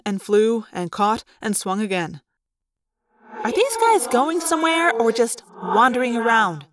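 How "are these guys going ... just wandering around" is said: raised-pitch grumble, mildly put out; a touch of impatient grumbling. Acted. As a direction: complain in a lightly put-on character voice. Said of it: "Rachel grumbled".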